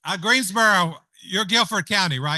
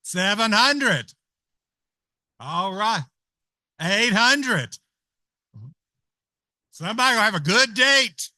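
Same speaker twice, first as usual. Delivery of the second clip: louder, livelier auction call; auctioneer calling bids; 2 semitones higher, 8 dB louder, slower than usual. Acted. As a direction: push the bidding up with energy.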